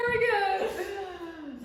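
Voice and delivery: high, slow, laughing gasp of praise; gasp, then gush over her singing; gasping delight. Real, not acted. Praising Hannah's singing with a gasp and a laugh.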